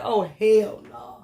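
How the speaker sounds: slow, drawn-out hell no; mock outrage. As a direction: stretch every word in disbelief. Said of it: "I was like".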